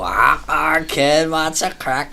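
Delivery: put-on very slow, drawn-out drunk greeting; imitating a drunk passenger's slow greeting. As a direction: stretch it like a happy drunk. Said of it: "spoke like that there".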